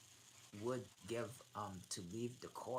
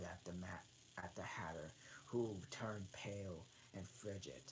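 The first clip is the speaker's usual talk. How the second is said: whispering; 4 semitones lower, 6 dB quieter than usual. A narrator's reading. Narration drops to whisper.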